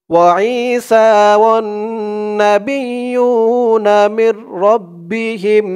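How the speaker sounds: slow, high melodic tilawat; measured tajweed chant; Quran recitation. Acted. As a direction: chant the verse slowly and reverently.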